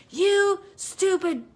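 high, slow sneering jab, hammed-up; exaggerated mocking put-down; mock insult. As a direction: pretend to snap an insult at someone. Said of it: "Don't be like".

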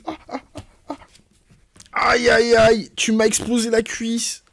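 aïe aigus et forts, griffé par le chat; cri de douleur. Real, not acted. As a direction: hurle de vraie douleur, plus haut et plus fort. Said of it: "qui m'a griffé toute la cuisse". (cri de douleur sous la griffure du chat)

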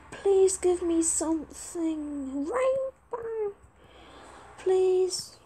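high, drawn-out begging; pleading. Real, not acted. Player begs another for a rainbow in a trade, pleading. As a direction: plead slowly, rising into a high squeal.

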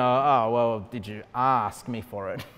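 very low, soft mock-divine voice; hushed, deep parody of heavenly judgement; God. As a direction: play a pedantic deity, dragging out "ask". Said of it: "God's gonna say".

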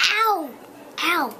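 laughing loud ow; loud yelp. Real, not acted. Child being tickled, nails hurt.

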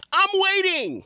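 high, sing-out impatient demand; escalated mock impatience.

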